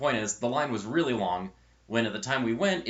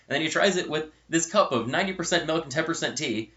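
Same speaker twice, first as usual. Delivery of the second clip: ordinary talk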